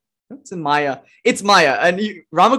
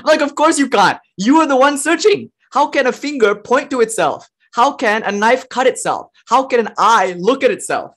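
passionate outburst; 6 semitones higher than usual. Real, not acted. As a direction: push the words out with real heat. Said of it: "I'm getting passionate here".

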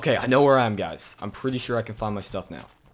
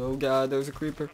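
usual speaking voice

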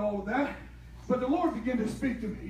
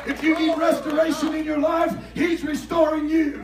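loud, rising preacher's cry; preaching at full force; 4 semitones higher, 6 dB louder than usual. Acted. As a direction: preach it hard to the congregation.

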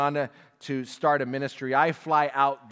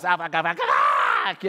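a lighter, less pitched mock of the duck's Aflac call; squawking toy duck voice; 4 dB louder than usual. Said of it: "it goes".

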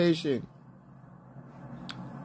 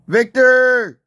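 loud, higher drawn-out yell; calling out; 5 semitones higher, 7 dB louder than usual. Real, not acted. Player calling his teammate, loud high holler. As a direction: holler the name for someone far away.

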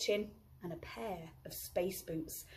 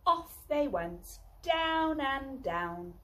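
ordinary delivery

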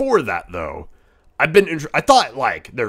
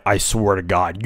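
ordinary talk

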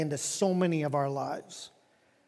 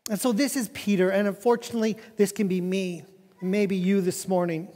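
ordinary talk

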